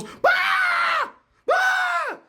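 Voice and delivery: very high bleating goat yell; mock goat scream, way up high. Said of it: "the goat goes".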